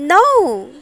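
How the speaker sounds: high, loud witch shriek. A narrator's reading. Witch doused with water shrieks high and loud. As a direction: shriek it out in panic. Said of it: "shrieked the witch".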